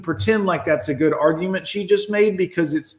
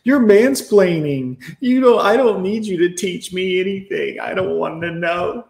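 mocking woman's retort; 3 semitones higher than usual. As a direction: sneer it like a scornful woman. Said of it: "what happened when we try to explain".

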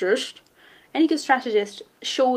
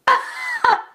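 high laugh. Real, not acted. Laughing at the ship name.

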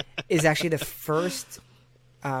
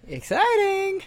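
much higher, slow mock enthusiasm; sarcastic cheer; 5 dB louder, slower than usual. Real, not acted. Cohost teases about a nine volt horn, slow mock cheer. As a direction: fake excitement, teasing.

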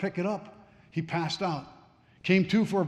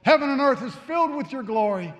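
crying out in praise; 5 semitones higher, 5 dB louder than usual. Retold or imitated. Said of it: "started crying out".

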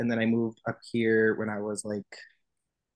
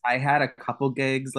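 ordinary talk

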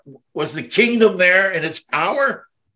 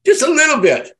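a bit less high, still raised and louder; little squeak; 12 semitones higher, 4 dB louder than usual. Shown as a demonstration. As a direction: echo the tiny amount, squeaking.

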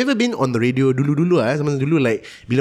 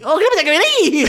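mall MC voice; 13 semitones higher, faster than usual. Retold or imitated. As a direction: grill the kid like a cheesy mall MC. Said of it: "the M Cs at the Giant".

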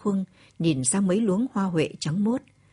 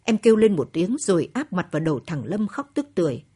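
plain reading voice

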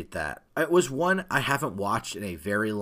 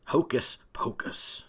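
whisper; 2 semitones higher, slower than usual. Acted. Dramatic title reveal whisper.

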